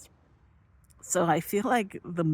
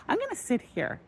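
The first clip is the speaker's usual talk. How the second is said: playful high voice; 13 semitones higher than usual. Retold or imitated. Retelling obeying the slot machine.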